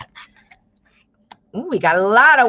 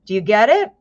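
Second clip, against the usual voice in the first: ordinary delivery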